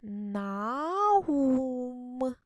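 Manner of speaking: "Наум" stretched slowly, syllable by syllable, as "На-ум"; slow reading aloud. Shown as a demonstration. Teacher models reading "Наум" for first graders, slow and stretched. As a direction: sound out "Наум" slowly, like a child learning to read. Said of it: "давайте прочитаємо".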